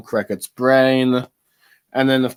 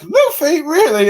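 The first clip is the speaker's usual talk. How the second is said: very high, louder incredulous squeal; shocked Vivi; 4 dB louder than usual. Retold or imitated. Reviewer voicing Vivi reacting to the broadcast, high shocked squeal. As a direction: squeak it in disbelief. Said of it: "Vivi going like".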